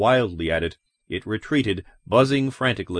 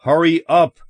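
loud, raised-pitch bark; barked order; 6 semitones higher, 7 dB louder than usual. A narrator's reading. Father hurrying his children downstairs, barking. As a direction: bark it out as an impatient command. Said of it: "Ed barked".

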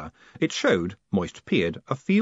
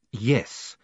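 ordinary delivery, nothing marked

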